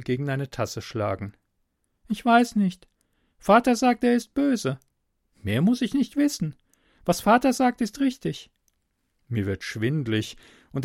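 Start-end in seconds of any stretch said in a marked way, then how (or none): 2.1-8.5 put-on very high girlish voice, insistent; captive girl, dogmatic. Girl repeats father's word to stranger, high and certain. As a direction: recite father's creed as a trusting girl, pitched high.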